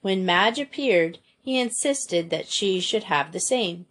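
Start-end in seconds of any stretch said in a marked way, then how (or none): none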